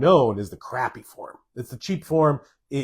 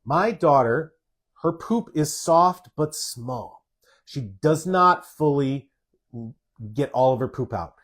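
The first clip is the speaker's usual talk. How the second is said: slower reread; 2 semitones higher, slower than usual. Shown as a demonstration. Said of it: "I'm gonna read that again".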